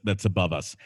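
ordinary talk, nothing marked

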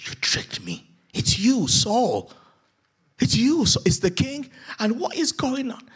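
high, loud, alarmed cry of discovery; startled witch shrieking at the king; panicked witch. Acted. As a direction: recoil as she realises who he is.

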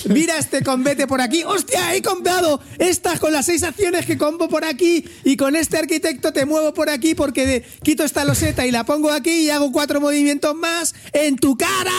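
high, gloating play-by-play; excited. Pretend. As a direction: brag about the combo, thrilled. Boasting about a game combo.

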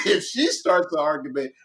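ordinary talk

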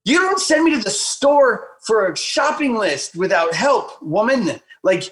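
high, whiny mock protest at his wife; mock exasperation. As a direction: play up the outrage for laughs. Mock-scolding wife over phone.

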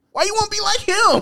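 very high, loud put-on voice; mock nagging coworker. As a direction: pester like a squeaky coworker.

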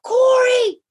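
high, loud yell across the beach; calling out to a lost brother; screaming a name. Retold or imitated. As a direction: shout his name to find him. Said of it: "And I scream out".

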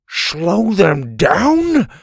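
loud, high, drawn-out question; panicked disbelief. A narrator's reading. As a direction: stretch it out, incredulous. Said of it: "in a panic".

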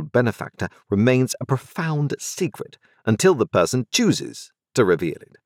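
cool, businesslike lawyer laying down terms; a hint of a dry, formal lawyer; stern lawyer. Jaggers continues to Pip.

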